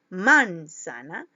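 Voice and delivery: "manzana" said slowly as "MÁN-za-na", first syllable loud; stress on first syllable. Shown as a demonstration. Teacher trying out stresses for pupils, first syllable hit in "manzana". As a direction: wrongly punch the "man" of "manzana". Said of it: "jugando con la intensidad de la voz".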